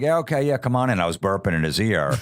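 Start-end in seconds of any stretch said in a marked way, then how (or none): 0.0-1.0 much higher, a lightly voiced friendly manager; imitating an upbeat boss. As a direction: play the eager hiring guy. Hiring manager voiced in story.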